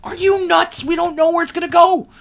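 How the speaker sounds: high, loud alarmed yell; shrieking in mock horror; panicked shout. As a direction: yell it at your friend in disbelief. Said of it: "I'm like".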